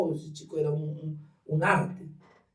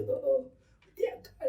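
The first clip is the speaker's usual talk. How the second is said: refusing the brother; 11 semitones higher, 4 dB quieter, slower than usual. Retold or imitated. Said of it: "le digo".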